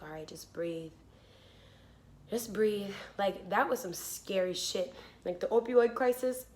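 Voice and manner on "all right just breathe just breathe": soft, low reassurance; calming dad. Retold or imitated. Dad calming panicked daughter on phone, soft. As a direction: soothe her down gently. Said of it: "My dad calls and he's like".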